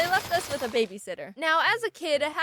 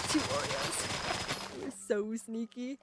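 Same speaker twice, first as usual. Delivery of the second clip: sneaky aside; 8 dB quieter, much slower than usual. Real, not acted.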